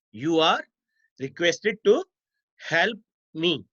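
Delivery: "You are", pause, "requested to", pause, "help me"; dictation in chunks. Shown as a demonstration. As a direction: pause after "are" and after "to", as if students are writing. Repeats example in chunks for students.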